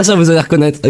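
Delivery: ordinary talk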